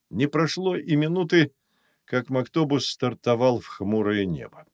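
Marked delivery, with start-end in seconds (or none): none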